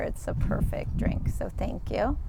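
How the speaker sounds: usual speaking voice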